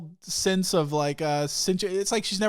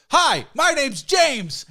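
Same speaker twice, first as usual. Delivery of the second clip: loud, high, drawn-out greeting; hammed-up booming introduction, far too keen; overeager stranger; 10 semitones higher, 8 dB louder, much slower than usual. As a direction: imitate an intrusive, overfriendly stranger. Stranger barges in to introduce himself.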